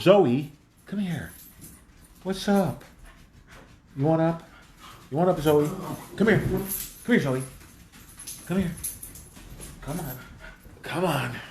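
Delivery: gentle, coaxing call to a pet; coaxing the dog. Real, not acted. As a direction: sweet-talk the dog over to you. Calling and coaxing the dog Zoe.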